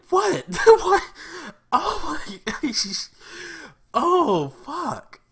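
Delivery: very high, loud squealing what with laughter; shrieking disbelief. Retold or imitated. Replaying own reaction to the episode, in a shrieking squeal. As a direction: scream it out, totally floored. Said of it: "I'm like".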